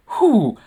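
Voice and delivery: high, loud swooning "whew"; flustered whew. Acted. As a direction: let out a big smitten whew. Padme gushing about her crush.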